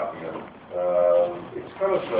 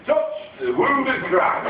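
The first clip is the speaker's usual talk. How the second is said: higher, slow northern English Christ; northern English accent; 10 semitones higher, slower than usual. Retold or imitated. Christ from the north of England inviting a touch, slow northern English accent. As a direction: give it a broad northern English lilt, slowly. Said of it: "he was from the north of England".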